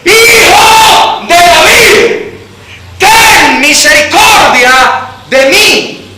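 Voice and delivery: slow, high, loud desperate cry; pleading shout. Acted. Blind beggar crying to Jesus, slow pleading shout. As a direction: cry out for mercy with everything. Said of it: "Y él vuelve a gritar".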